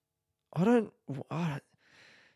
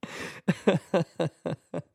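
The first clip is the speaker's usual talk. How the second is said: laughter; 5 semitones higher, 4 dB louder than usual. Real, not acted. Laughing at own plan.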